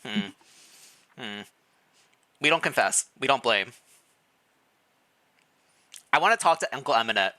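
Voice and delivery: usual speaking voice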